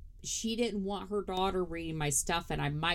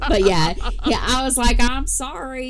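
loud laughing through the words; chuckling loudly while speaking; 7 semitones higher, 12 dB louder than usual. Real, not acted. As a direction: still cracking up. Laughing punchline continues.

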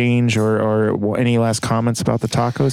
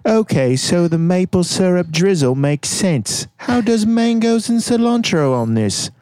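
mock British accent, high and slow at first; lightly hammed British reading of the review; 6 semitones higher than usual. Host finishes Kurt D's review in a mild British accent. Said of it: "Kurt D in my best British accent".

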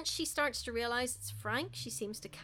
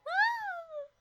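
short high mock shriek; mock scream; 3 dB louder than usual. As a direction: yelp like the grab just got you. Hand grabs her ankle.

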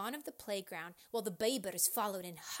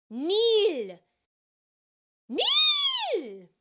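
high, drawn-out yell; screamed order; 12 semitones higher, 17 dB louder than usual. A narrator's reading. Hippopotamio ordering teacher down, high loud scream. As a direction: shriek it, second one higher. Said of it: "a hippopotamio screamed".

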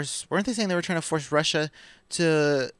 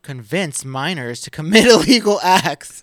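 louder and higher, breaking into laughter; incredulous laughing read; 4 semitones higher, 12 dB louder than usual. Real, not acted. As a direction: rise into loud, laughing disbelief. Laughs at absurd claim while reading.

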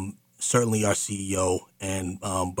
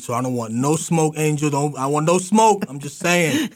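higher-pitched mock backing-off, climbing as it goes; hammed-up "don't want trouble" disclaimer; playful protest; 7 semitones higher, 5 dB louder than usual. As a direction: jokingly plead innocence, close to laughing. Joking disclaimer about married guest.

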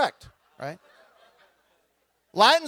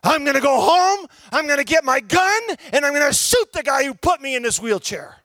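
voicing the angry man; 4 semitones higher than usual. Man in wheelchair voiced.

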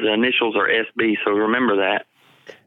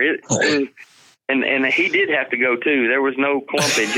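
ordinary talk

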